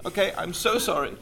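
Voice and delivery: ordinary delivery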